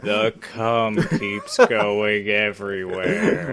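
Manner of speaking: slow, low monotone drone; monotone impression. Co-host mocking his own filthy writing, monotone drone. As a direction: drag every word out in a deadpan monotone. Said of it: "with that monotone voice".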